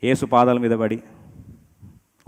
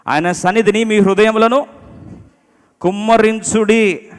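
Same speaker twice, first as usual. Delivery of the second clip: slow, loud, raised-pitch verse reading; scripture proclamation; 8 semitones higher, 7 dB louder, slower than usual. Real, not acted. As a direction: lead the congregation through the verse, slowly and loudly. Reads verse aloud with congregation.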